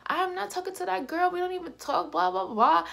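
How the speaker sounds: high, indignant mock denial; defensive denial. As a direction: imitate him denying it, pitched high. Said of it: "He was going off".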